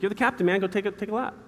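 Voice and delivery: high-pitched retold coaching order; imitating dad insisting. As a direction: overrule the kid, pitched well up. Dad overrules the protest.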